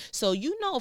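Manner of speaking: ordinary talk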